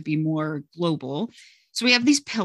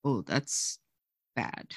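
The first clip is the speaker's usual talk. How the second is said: low, hushed imitation of dismayed kids; hushed mock dismay; dismayed kids' reaction; 7 semitones lower, 8 dB quieter, slower than usual. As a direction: drop low and soft, as if it sinks in. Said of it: "Every kid in the room is like".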